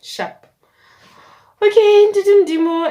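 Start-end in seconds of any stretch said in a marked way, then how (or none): 1.6-2.9 loud high burst of excitement; a loud, high cheer; excited. Real, not acted. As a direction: blurt it out thrilled. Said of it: "excited exclamation".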